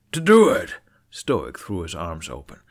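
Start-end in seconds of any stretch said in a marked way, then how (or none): 0.1-0.8 roaring, much louder; furious shout. Acted. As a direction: bellow it back at the boy. Father roars back at son.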